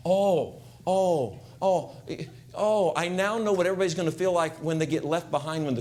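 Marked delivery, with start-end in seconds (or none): none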